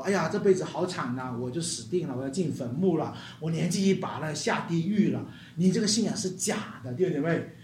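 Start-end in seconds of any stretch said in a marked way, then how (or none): 0.1-5.2 soft, lower hopeless lament; mock despair. Preacher imitates a hopeless unbeliever, mock lament. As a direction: sigh out a doomed life. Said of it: "如果有人说没有复活".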